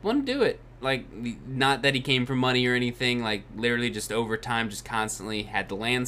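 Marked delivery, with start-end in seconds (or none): 0.0-0.6 squeaky high voice for the builder; high squeaky quote. Retold or imitated. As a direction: pitch it way up, squeaky. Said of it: "He's like".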